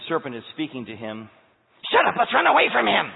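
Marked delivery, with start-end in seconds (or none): 1.9-3.1 much higher, loud frantic cry; frightened creature shouting; panicked shout. Acted. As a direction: yelp as if cornered. Serpent panics at angel.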